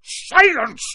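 huge, high explosive yell. A narrator's reading. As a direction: yell to shut the boy up. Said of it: "yelled Uncle Vernon".

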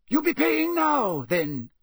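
high, loud country voice; a wary old Scots countryman; rustic campsite owner. Acted.

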